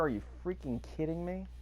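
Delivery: ordinary talk, nothing marked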